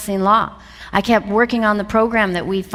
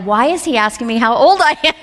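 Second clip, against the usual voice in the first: high, laughing recollection of confusion; amused bafflement; 3 semitones higher, 4 dB louder than usual. Retold or imitated. Speaker recalling her mentor's odd question, baffled. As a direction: tell it puzzled, pitched up and amused. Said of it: "looking at him baffled".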